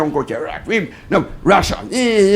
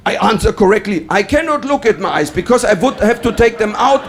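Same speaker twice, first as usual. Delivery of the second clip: ordinary delivery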